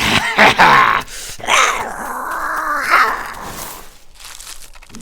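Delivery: long, very high screeching laugh; shrieking cackle. Acted. Werecat captain cackles over his caught prey, shrieking. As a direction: cackle wildly like a beast.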